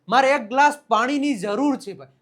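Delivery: high, loud demand to a listener; loud acted request. Calls out example request for water.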